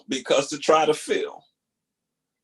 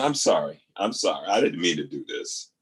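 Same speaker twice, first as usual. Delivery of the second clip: apologizing; 9 semitones lower than usual. Retold or imitated. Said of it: "I'd start apologizing".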